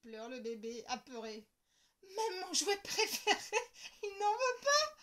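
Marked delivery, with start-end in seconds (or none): 2.2-4.9 put-on high, loud bewildered child; can't believe he refuses; girl hurt by the rejection; puzzled hurt. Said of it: "se dit Lola qui ne comprend pas".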